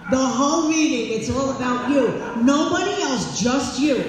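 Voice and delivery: loud emphatic stress on meeting. Real, not acted. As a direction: insist it's all about you. Emphatic about celebration.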